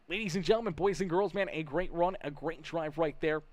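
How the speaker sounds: big ring-announcer send-off for a driver. Acted. Broadcaster calls for applause for Thomas Horvath, announcer voice.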